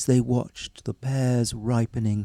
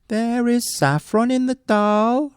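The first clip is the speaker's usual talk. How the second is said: casual; 11 semitones higher than usual. Acted. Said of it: "remarked the woodman casually".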